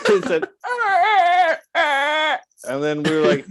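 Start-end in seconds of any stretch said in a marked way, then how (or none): 0.1-2.4 high, loud mock animal shriek, broken into gasps; hammed-up high wail of a dying animal; dying antelope cry. Antelope cry after being stabbed.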